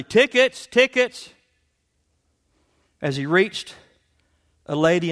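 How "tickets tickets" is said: high, loud conductor call; calling loudly. Acted. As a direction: shout it down the train car. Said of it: "calling loudly".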